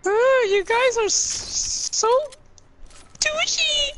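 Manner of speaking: high fake crybaby whine; whiny high voice. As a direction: whine like a bullied kid. Whining about being bullied.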